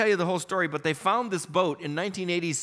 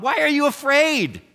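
loud rebuke; 8 semitones higher, 6 dB louder than usual. Acted. Jesus rebukes the disciples.

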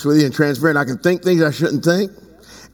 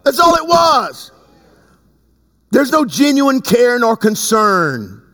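shouted; 7 semitones higher, slower than usual. Real, not acted. Preacher's outburst on politicians.

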